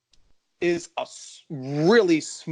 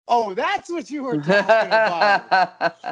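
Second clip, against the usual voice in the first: laughing realization; 6 semitones higher, 5 dB louder, slower than usual. Retold or imitated. Realizing coach's meaning, laughing.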